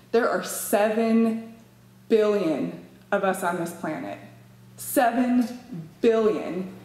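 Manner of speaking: "seven billion" slowed, with a pause between the words; emphatic number. Acted. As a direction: spell out "seven ... billion" word by word. Hammering the world population figure.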